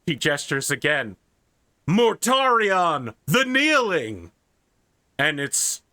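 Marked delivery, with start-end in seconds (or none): 1.8-4.3 drawn-out, ringing announcer call; grand introduction, stretched words. Acted. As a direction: announce the name with ceremony, slowly.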